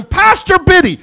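loud, high imitated scream; retold scream. Young woman screaming at the pastor from the street, mock scream. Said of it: "starts screaming".

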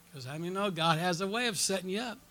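normal talking voice

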